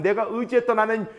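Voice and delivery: ordinary talk, nothing marked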